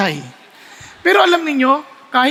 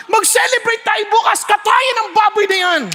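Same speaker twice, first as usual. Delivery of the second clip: gleeful owner; 8 semitones higher than usual. Acted. Owner ordering the pig slaughtered, high gleeful shout. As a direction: call for a feast with glee.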